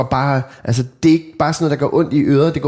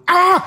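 piercing high shriek, louder; 4 dB louder than usual. Retold or imitated. Little girl screaming back at dad, high shriek.

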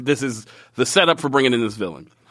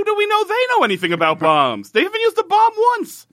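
exasperated rant. Real, not acted. Ranting about lazy writing.

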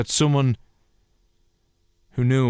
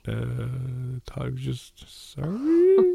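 a hammed-up meek apology to the upset colleague; sheepish apology; 2 semitones higher, much slower than usual. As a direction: sound small and guilty, stretching it out. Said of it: "go kind of back in".

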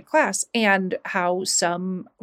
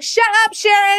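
high, loud mock classroom yell; fed-up retold shout, pitched way up; exasperated yell; 10 semitones higher, 6 dB louder than usual. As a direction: shout down the annoying kid. Whole class yelling at her, retold.

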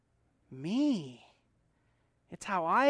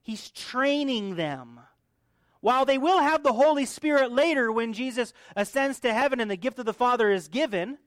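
usual speaking voice

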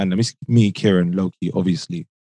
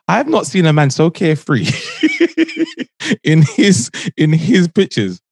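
laughing; 6 semitones higher than usual. Real, not acted. Laughing through the story.

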